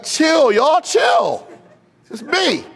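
high, loud acted call. Jesus calls to frightened disciples from the water, calling out. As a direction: shout over the panic to settle them.

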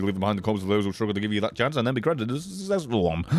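rattled off very fast in one breath; rapid rant. Real, not acted. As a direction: blurt the whole rant without pausing. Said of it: "should have taken a breath".

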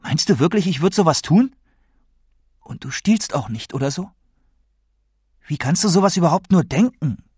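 indignant anger. Acted. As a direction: protest as if wrongly accused. Grandson angrily denies dealing drugs.

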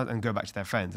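usual speaking voice

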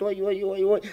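high, flustered repeated oy; flustered cries. Acted. Girl turning away flustered, high cries. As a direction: squeal it out, embarrassed. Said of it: "знай, только твердит".

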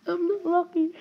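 high, whimpering lament; mock wail. Player lamenting having no luck, mock wailing. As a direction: wail it like a hard-luck case.

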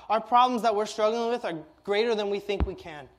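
usual speaking voice

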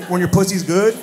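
usual speaking voice

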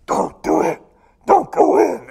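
loud, forced otherworldly whisper-growl; eerie warning voice. Retold or imitated. A voice warns him at the church step, eerie warning. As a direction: imitate a sinister voice warning someone off. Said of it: "it sounded just like that".

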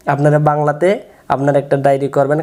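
ordinary talk

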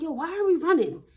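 yo, why are we running, panting and confused; winded friend on a fast walk, breathless protest; breathless alarm. Retold or imitated. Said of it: "Then I'm getting out of breath".

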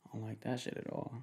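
ordinary talk, nothing marked

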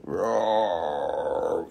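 low, soft play roar; mock roar. Seller roars playfully at her dog, low and soft.

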